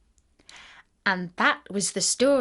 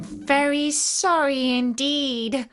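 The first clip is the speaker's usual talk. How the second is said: put-on slow, higher girl's apology; frightened little girl; 5 semitones higher, slower than usual. A narrator's reading. Dorothy to the melting witch, frightened apology. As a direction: apologise slowly in a small girl's voice. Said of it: "who was truly frightened".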